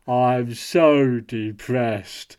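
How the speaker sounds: slow deadpan monotone "I'm so depressed."; flat monotone. Retold or imitated. Mocking flat poker face.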